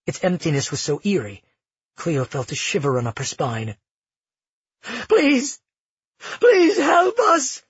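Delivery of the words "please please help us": high, loud, drawn-out plea; mournful cries. A narrator's reading. Trapped people cry up from a pit, desperate. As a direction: wail desperately for help. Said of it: "the mournful sound of cries".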